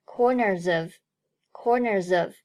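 "corners of" joined, z sound carried into "of"; linked pronunciation. Shown as a demonstration. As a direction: link "corners of" as one word. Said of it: "最后corners和of连读".

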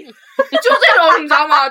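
bursting into loud laughter; laughing. Real, not acted. Friend cracking up while mocking the teacher, loud laugh. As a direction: laugh it out, very slow.

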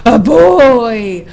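loud, drawn-out bear yell. A narrator's reading. Bear startled by a boy, yelling. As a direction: bellow it in alarm. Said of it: "yelled the bear".

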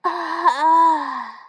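loud drawn-out stretching groan; big waking stretch. A narrator's reading. The rabbit stretches after a long sleep, loud stretching groan. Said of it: "蹦蹦使劲伸了个懒腰".

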